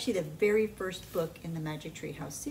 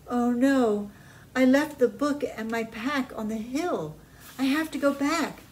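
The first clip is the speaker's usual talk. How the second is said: put-on higher, louder worried boy; voiced Jack, upset; 6 semitones higher, 6 dB louder than usual. A narrator's reading. Jack upset he left the book, worried. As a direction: fret over the lost pack.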